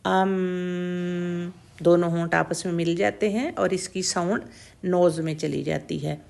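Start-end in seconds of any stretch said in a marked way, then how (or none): 0.0-1.5 ام with closed lips humming through the nose; sakin meem demo. Shown as a demonstration. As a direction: hold ام, sound going into the nose. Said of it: "ساکن کر کے پڑھیں تو ہم کہیں گے". (ام کو ہونٹ بند کر کے ناک میں گنگنائیں)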